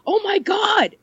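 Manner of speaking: rising into a high-pitched, appalled reaction; imitated disbelief. Retelling his reaction to hearing old podcasts, incredulous. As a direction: mimic his mounting dismay.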